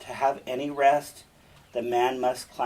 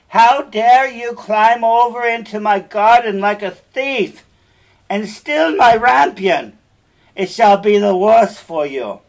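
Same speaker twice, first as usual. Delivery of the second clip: furious witch, much higher and much louder; angry witch shout; 9 semitones higher, 14 dB louder than usual. Acted. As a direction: scream at the thief caught in your garden. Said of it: "as she cried with angry eyes".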